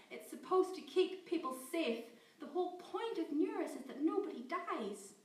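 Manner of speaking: loud, high panicked torrent; words tumbling out in fear. Acted. Scientist Amy panicking as she defends her program. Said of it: "in a wave of panic".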